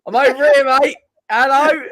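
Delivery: hammed-up shout to be noticed; mock indignant call. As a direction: wave yourself into notice, hammed-up. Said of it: "And I'm like".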